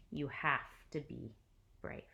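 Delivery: ordinary delivery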